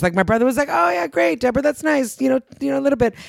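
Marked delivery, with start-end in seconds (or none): 0.7-2.2 imitating polite indifference. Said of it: "nobody really cared that much".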